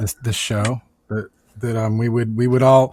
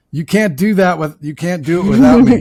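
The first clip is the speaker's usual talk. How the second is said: mock insistent friend; 5 semitones higher, 6 dB louder than usual. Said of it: "and you said".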